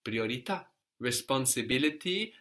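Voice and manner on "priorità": usual speaking voice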